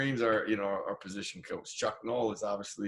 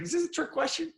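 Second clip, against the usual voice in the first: pitched way up in disbelief; puzzled disbelief. Retold or imitated. Thinking it when the scout asks about basketball, high puzzled disbelief. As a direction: sound caught off guard by an odd question. Inner reaction to scout's question.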